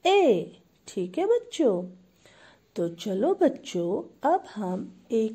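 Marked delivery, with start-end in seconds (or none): 0.0-0.6 loud, high ए demonstrated; model vowel. Shown as a demonstration. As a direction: sing out ए for the class. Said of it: "कैसे बोलते हैं?".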